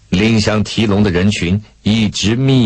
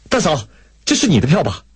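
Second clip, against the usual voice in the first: eager call; 8 semitones higher, faster than usual. A narrator's reading. Rushes over with the bought ticket.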